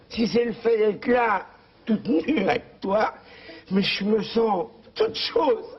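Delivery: vieille dame toute émue, voix haute et lente; fais-la fondre d'émotion, lentement; high, slow swooning old wife. Retold or imitated. (la vieille parle à Léon, voix haute, lente et pâmée)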